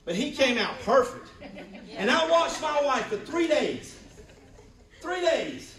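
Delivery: high, loud, laughing cry of feeling; emotional cry. Real, not acted. Pastor telling church about watching his wife labor, loud and moved. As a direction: cry out in wonder at the wife's endurance.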